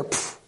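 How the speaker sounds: quick unvoiced puff through the lips; brief dismissive puff of air; short pfft noise. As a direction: puff it out like a sudden check. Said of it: "the Spirit of God just went".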